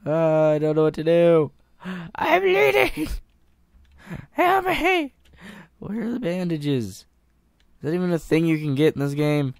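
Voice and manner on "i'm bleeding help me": high, loud panicked cry for help; hammed-up bleeding panic; mock panic. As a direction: play up the panic, half laughing. Character bleeding in game.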